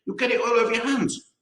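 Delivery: fussing. A narrator's reading. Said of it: "cried Missus Twit".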